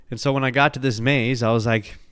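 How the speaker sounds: normal talking voice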